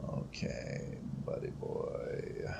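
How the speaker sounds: stealth whisper. Real, not acted. Streamer creeping up on Itto, slow whisper. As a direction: hush it like you're sneaking up.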